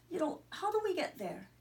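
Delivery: asking the way in a high Inverness brogue; Scottish brogue asking a B&B lady for directions. Retold or imitated. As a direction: lilt the question like a Highlander. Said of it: "Your accent tells me you're from Inverness".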